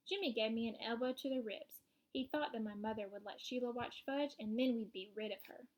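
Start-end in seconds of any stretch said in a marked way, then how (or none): none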